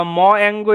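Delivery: "ম অ্যাঙ্গো" with ম as a full mo syllable; Bengali letter-name reading. Shown as a demonstration. Teacher warning what we don't say, letter-name reading. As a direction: give "ম" its vowel, then "অ্যাঙ্গো". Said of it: "এরকমভাবে বলি না কিন্তু".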